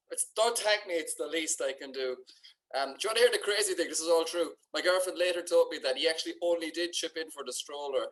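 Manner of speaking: normal talking voice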